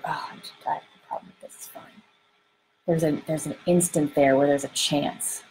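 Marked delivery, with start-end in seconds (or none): 0.0-2.0 almost whispered, tossed-off denial; much softer, swallowed words, playing it down; hushed brush-off. Driver going straight into defense after a fender bender, hushed.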